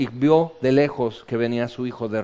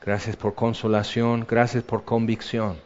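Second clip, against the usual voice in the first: heartfelt prayer; 3 semitones lower than usual. Acted. Pastor praying with the standing congregation, hushed and grateful.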